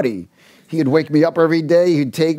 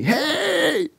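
high calling yell, imitating the dude; retold yell from afar; 13 semitones higher, much slower than usual. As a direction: call out high like a guy shouting across the park. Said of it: "the dude's yelling at me".

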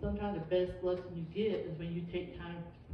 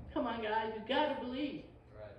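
ordinary talk

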